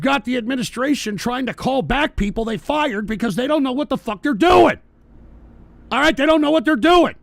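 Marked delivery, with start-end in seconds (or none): 3.1-7.1 loud, high exasperated outburst; indignant shouting. Real, not acted. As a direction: drive it home with rising outrage. Indignant rant about incompetence.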